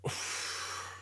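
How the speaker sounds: breathy collapsing sigh out; imitated slumping sigh. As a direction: copy how the public sighs the air out. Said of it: "they go".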